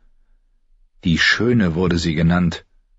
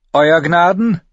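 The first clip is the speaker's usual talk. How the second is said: higher voice, unmuffled; Brienne answering; 11 semitones higher, 5 dB louder than usual. A narrator's reading. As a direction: address the king clearly. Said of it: "antwortete Brienne".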